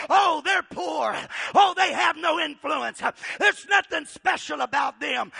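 scornful crowd. Acted. Acted sneer of the crowd.

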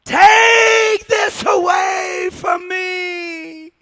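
loud, high, slow anguished cry to the Father; Jesus crying out in Gethsemane; Jesus pleading. Acted. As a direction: beg for the cup to pass, crying out. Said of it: "He's gonna say".

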